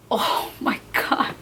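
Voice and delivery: slow, hushed disbelief; shocked whisper. Retold or imitated. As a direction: whisper it, stunned by the news. Reaction to shocking baby news.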